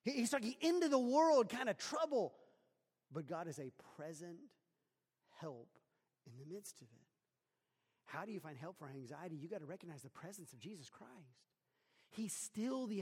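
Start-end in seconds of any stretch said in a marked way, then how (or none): none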